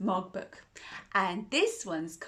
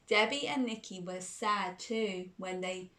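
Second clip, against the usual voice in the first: ordinary delivery, nothing marked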